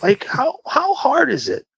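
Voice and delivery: high-pitched, drawn-out frustration; slow, high, incredulous complaint; exasperated. Retold or imitated. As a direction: stretch it out in fed-up disbelief. Fed up with extra passengers.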